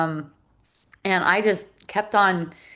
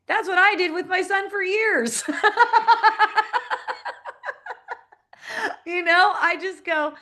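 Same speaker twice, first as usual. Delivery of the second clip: high, laughing admission; 10 semitones higher than usual. Real, not acted. Admitting to a friend her years with her son, laughing. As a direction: giggle while owning up.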